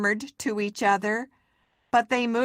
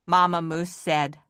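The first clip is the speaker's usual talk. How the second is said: ordinary delivery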